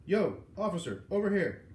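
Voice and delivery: calling a cop over across the lot, loud; insistent call. A narrator's reading. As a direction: shout to get the officer's attention. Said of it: "beckoned insistently".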